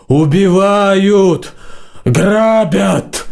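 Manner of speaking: drawn-out roar. A narrator's reading. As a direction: stretch each cry for help long. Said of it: "протяжным басом взревел Варан".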